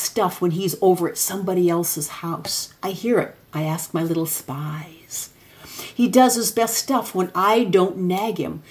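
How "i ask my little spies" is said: secretive whispered aside. Real, not acted. Conspiratorial aside about spies.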